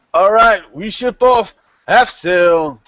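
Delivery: high, loud, drawn-out sailor's call; shouted relay. Acted. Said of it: "he relays the message back".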